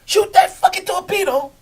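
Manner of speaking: high, loud barked order; captain's order. Retold or imitated. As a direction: yell the order like a sub captain. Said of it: "the captain come in".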